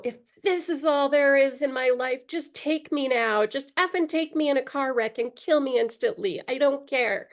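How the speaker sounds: retold scream. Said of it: "I was just screaming out loud".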